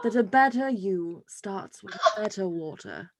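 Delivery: posh British reading a label; fancy rich Brit voice; British accent. Acted. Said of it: "I like to do British".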